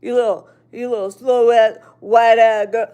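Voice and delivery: loud put-on thug insult; jeering imitation, punched loud; mocking thug talk. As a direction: mimic a mouthy kid insulting an elder. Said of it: "running around talking like that to their elders".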